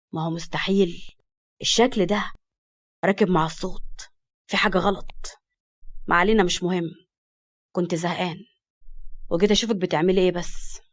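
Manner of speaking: a teasing man's voice, low and unhurried; lightly voiced Farid. (صوت فريد منخفض ومشاكس)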